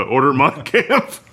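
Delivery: drawn-out American pronunciation of Mein Kampf; bad German accent. Joking order to Alexa, bad German accent. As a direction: mangle the German in a loud American accent, rising at the end. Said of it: "with such a bad accent".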